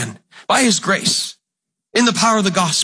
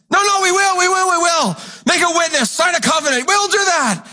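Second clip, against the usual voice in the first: much higher, shouted pleading crowd; protesting people; 11 semitones higher than usual. Retold or imitated. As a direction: beg to be believed, shouting.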